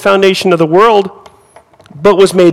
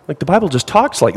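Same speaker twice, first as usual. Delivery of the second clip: ordinary delivery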